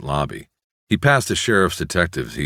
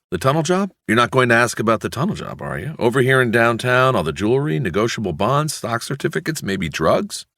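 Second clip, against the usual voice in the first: eager, higher, rushing questions; urgent excitement; 9 semitones higher than usual. A narrator's reading. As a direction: press him, hungry for the story. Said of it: "voice go up a notch in urgency".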